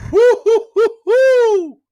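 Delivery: loud high whoo-hoo cheer; excited hooting. Real, not acted. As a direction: cheer like a fan in the stands. Host cheering for Miami fans.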